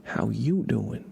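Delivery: slow greeting with a swooping "you"; stress on YOU. Shown as a demonstration. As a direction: lean slowly and firmly on "you". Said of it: "that's how you wanted me to say it".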